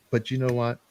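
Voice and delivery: ordinary talk, nothing marked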